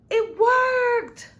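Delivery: high, joyous exclaim; triumphant cry. A narrator's reading. The narrator cheers as the lost elephant returns, shouting with joy. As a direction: burst out with delight.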